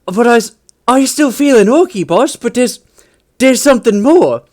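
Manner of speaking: a brute discovering his cute side; dreamy orc. Retold or imitated. Transformed orc confessing new feelings to the boss, dreamy. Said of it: "the orc is just like".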